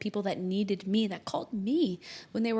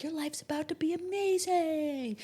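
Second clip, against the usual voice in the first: mock upbeat promise; 8 semitones higher than usual. Mocking cheerful astrology promise.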